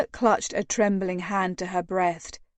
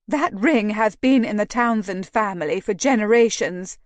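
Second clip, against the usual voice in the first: plain reading voice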